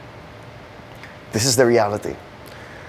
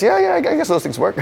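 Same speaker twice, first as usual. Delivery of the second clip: much higher, noncommittal mock answer; unconvinced improvising; 9 semitones higher than usual. Pastor makes up an answer for the driver, comically unsure. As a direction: bluff it in a high, unsure voice.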